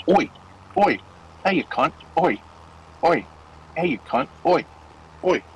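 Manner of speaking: mock British car noise; lightly voiced British accent. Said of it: "Does it sound British?".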